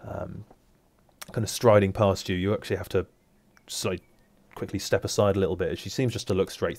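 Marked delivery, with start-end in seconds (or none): none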